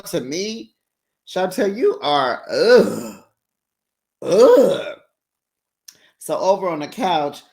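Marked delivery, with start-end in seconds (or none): 2.5-5.0 loud disgusted groan; exasperated ugh. Retold or imitated.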